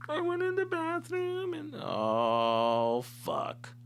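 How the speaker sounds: put-on slow, high-pitched whimpering girl; mock crying confession. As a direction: drag it out like a weepy girlfriend. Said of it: "she tells me she's crying".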